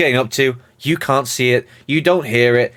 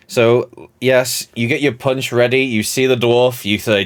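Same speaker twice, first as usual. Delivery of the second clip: ordinary delivery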